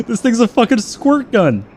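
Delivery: loud, exasperated gripe at a weak gun; frustrated outburst. Real, not acted. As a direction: vent the frustration loudly. Frustrated pilot, weak guns.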